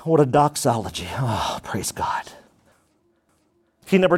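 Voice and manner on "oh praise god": slow, hushed whispered praise. Real, not acted. Preacher moved after the doxology, whispering slowly.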